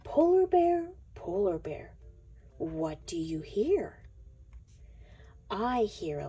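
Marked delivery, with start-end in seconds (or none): none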